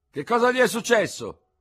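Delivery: high, loud shouted question; calling down. A narrator's reading. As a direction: call the question down to the street. Surgeon calls down from window.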